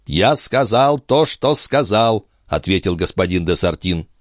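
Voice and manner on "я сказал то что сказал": slow, higher, deliberate retort; standing firm. A narrator's reading. Police chief refusing to retract his accusation, slow and firm. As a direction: stretch each word and concede nothing.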